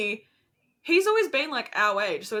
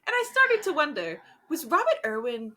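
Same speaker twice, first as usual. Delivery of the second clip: high musing voice-over, easing down; hammed-up pondering narrator; Carrie Bradshaw; 7 semitones higher than usual. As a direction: pose it like a TV columnist's narration. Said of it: "what Carrie Bradshaw would say".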